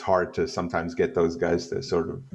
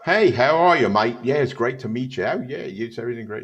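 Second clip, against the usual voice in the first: hammed-up English accent, higher at the start; 3 semitones higher than usual. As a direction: greet a fan in a mock English accent. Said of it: "that's my English accent".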